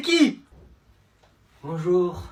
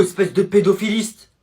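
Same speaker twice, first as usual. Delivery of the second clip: ordinary talk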